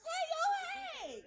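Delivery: high-pitched hollered greeting; quaint Southern holler. Acted. Comic shows her new greeting from the South, hollering. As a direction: call it out high and long. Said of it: "like I'm from the South".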